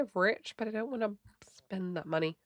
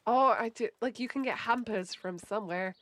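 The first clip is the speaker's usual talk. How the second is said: ordinary talk, nothing marked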